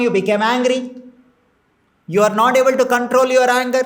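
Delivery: ordinary delivery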